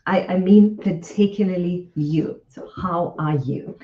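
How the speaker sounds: usual speaking voice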